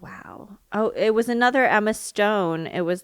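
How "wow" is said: breathy whispered wow; barely a whisper, awed. Retold or imitated. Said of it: "And I was just like".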